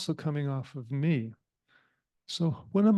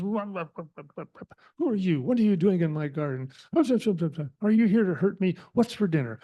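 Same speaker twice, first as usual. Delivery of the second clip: higher-pitched monkey chatter and jittery questions; agitated monkey; 3 semitones higher than usual. Acted. Storyteller as a monkey confronting the cat in his garden, agitated. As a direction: play a frantic, jabbering monkey. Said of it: "very agitated".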